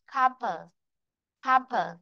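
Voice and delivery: "Campus" twice, CAM stressed, "pus" a falling schwa; unstressed second syllable. Shown as a demonstration. Teacher shows the unstressed vowel, second syllable weak. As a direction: let the end of "Campus" drop weakly. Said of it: "Vì nó không có đồng ấm".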